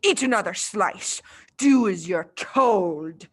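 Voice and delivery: slow, menacing headmistress order; slow, loud, threatening Trunchbull; sharp-edged command. A narrator's reading. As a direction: clip each word like a bully. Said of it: "an altogether sharper edge to her voice".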